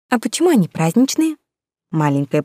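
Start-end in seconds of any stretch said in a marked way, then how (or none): 0.1-1.4 put-on high, eager child asking; bright curious little girl.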